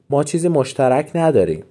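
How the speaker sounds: ordinary talk, nothing marked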